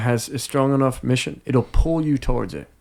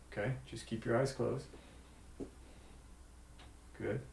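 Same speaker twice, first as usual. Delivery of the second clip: hushed magician patter; 11 dB quieter than usual. Acted. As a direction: ease them calmly into the trick. Leads a magic trick.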